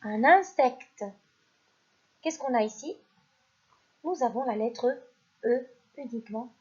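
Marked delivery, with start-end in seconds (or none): 0.0-1.0 "Un insecte" drawn out slowly; teacher dictating slowly to children; slow dictation. Shown as a demonstration. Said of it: "premier mot".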